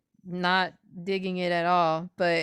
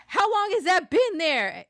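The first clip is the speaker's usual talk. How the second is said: higher, startled question; mock alarm; 9 semitones higher than usual. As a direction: imitate his dismay at the mark. Retelling his startled question.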